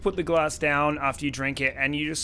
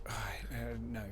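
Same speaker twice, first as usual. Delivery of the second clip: whispered, unvoiced dismay; 3 semitones lower, 10 dB quieter, much faster than usual. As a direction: breathe it out as a whisper. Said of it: "She just goes".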